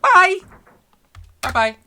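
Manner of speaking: loud cheerful bye, high; bright farewell call. Acted. Leaving for school, calling bye to mum, loud and cheerful. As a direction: call it out happily on the way out.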